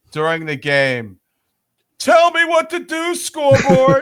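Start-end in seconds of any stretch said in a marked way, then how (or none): 2.0-4.0 high yelling at the scoreboard; high mocking yell. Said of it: "Mando would actually yell out".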